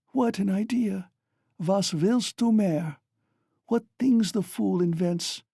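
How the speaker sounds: high, rushing delirious chatter; delirious mother recalling a dance and song; feverish excitement. A narrator's reading. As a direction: rave happily in fever. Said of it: "She was violently excited".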